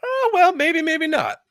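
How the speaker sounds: very high, loud mocking trill; falsetto sing-song. Real, not acted. Brushing off a friend's warning, falsetto sing-song. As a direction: tease back in a squeaky falsetto. Teasing threat reply.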